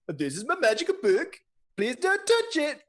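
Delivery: a vain king guarding his book; high king voice. Acted. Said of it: "and he says".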